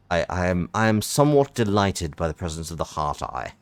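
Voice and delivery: usual speaking voice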